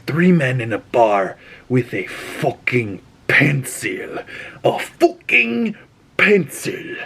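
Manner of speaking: quoting the film's legend of John Wick for effect; hammed-up movie line. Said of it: "I once saw him kill".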